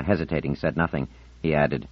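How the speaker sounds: ordinary delivery, nothing marked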